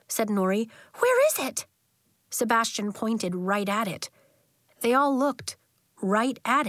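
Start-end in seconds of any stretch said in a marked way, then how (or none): 1.0-1.7 put-on high-pitched, excited little girl; eager child. A narrator's reading. Excited girl searching for owl.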